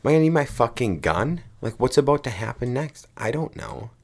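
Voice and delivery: high, worked-up retold alarm; mock panic. Visitor in the eerie dark office, comically panicked. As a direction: play up the horror-movie dread. Acting out horror-movie panic.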